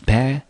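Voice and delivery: "pe" said alone as one drawn-out syllable; 分音节示范. Shown as a demonstration. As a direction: sound out "pe" as the first syllable. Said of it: "先把音节帮各位分一下". (把"pe"单独拉长念出)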